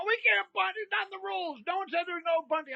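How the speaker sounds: high, indignant mock relatives' protest. Family players whining at him, high indignant mock protest. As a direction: squeak it out like outraged family.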